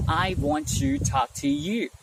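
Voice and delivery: slow, "want" and "to" kept apart; word-by-word. Shown as a demonstration. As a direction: separate every single word. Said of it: "Instead of saying".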